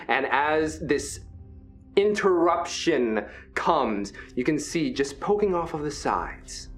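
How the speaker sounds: ordinary delivery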